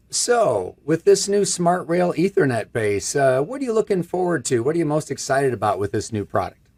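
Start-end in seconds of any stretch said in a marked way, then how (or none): none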